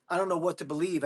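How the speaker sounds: normal talking voice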